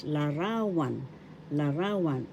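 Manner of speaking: larawan with the accent on the middle syllable; stress on RA. Shown as a demonstration. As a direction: lean on the middle of larawan: la-RA-wan. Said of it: "The accent is in the second syllable ra".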